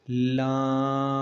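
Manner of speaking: "لام" drawn out slowly, the vowel held; stretched lam. Shown as a demonstration. Teacher shows pupils lam stretched out slowly. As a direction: lengthen "لام" a little. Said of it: "تھوڑا سا لمبا کر کے".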